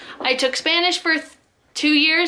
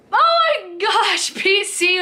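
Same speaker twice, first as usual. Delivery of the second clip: high drawn-out oh my gosh; laughing exasperation; 7 semitones higher, slower than usual. Real, not acted. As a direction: laugh at your own mistake. Flustered at own slip.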